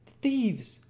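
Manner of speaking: put-on very high, urgent boy's voice; frightened Oliver. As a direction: blurt it out as an alarmed child. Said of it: "whispered Oliver".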